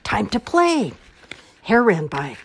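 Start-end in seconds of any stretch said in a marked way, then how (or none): 0.0-0.9 high, loud call; excited yell. A narrator's reading. Groundhog ready to play in the snow, excited yell. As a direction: call it out eagerly, pitch lifted. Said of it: "yelled Groundhog".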